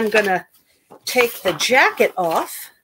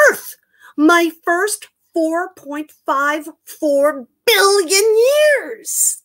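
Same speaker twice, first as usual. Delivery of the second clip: dramatic title read; 7 semitones higher, much slower than usual. Acted. Reading a book title dramatically.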